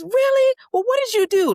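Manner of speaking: put-on very high, loud excited child voice; excited kid. Son hearing his cousin is in Panama, very high excited kid voice. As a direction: squeal it like a thrilled little boy.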